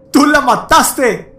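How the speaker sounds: high, slow furious scream; shout. A narrator's reading. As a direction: scream the accusation at him. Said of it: "le grité".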